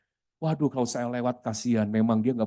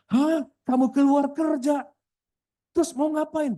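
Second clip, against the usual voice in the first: high-pitched, incredulous questioning; retold shock, voice shooting up; shocked disbelief; 12 semitones higher, 3 dB louder than usual. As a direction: act out being stunned by the news. Pastor acts his shock at resignation.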